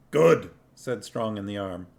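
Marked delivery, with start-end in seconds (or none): 0.1-0.5 higher, louder dwarf accusing; a louder, higher dwarf voice arguing. A narrator's reading. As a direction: insist like an angry dwarf. Dwarf sneering.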